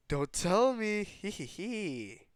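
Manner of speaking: mock gasp, then high, loud teasing and a giggle. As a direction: tease a friend with hammed-up shock and a snicker. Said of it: "so, uh, teases him".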